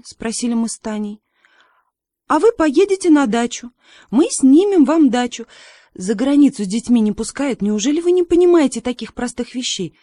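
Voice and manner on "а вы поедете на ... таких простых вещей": put-on higher, louder grown-up voice lecturing the girls; a firm parent dismissing the children's question; parents brushing off. Parents refusing the girls, firm and raised.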